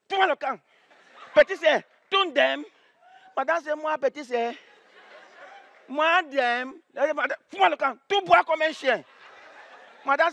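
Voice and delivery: high, rushed showing-off; a kid eagerly showing off his French to the teacher; excited recital. Retold or imitated. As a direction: proudly reel off the only French he knows. Kid insults teacher by mistake.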